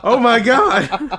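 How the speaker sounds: slow laughing oh my God; laughing disbelief. Real, not acted. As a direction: let it out through laughter.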